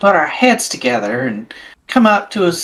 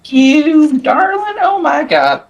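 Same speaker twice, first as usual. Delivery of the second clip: slow, drawn-out darling, pitched up; theatrical gush; 7 semitones higher, slower than usual. Acted. Player hams up thanks to the group, theatrical gush. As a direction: gush like a stage diva.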